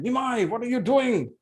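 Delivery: father scolding his son for eating the offering; angry scolding. Acted. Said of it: "He screamed at Nimai".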